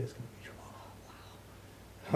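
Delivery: slow hush, voice nearly gone; hushed retelling. Retold or imitated. Diane tells him his mom is next, hushed. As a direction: drop into a slow near-whisper. Retelling the life-changing call.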